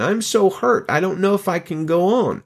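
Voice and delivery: high-pitched mock lament. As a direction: imitate an exaggeratedly wounded complainer. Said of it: "He said it in a very mocking".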